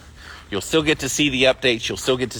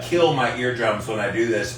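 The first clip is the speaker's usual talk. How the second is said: ordinary talk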